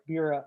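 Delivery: Biura with a B sound; Biura, B in place of V; Indian pronunciation. Shown as a demonstration. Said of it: "Biura, no".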